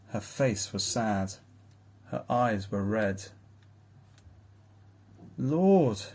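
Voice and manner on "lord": anguished gasp, raised in pitch; a sudden distressed outburst. A narrator's reading. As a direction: gasp it out, louder and higher. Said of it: "she gasped".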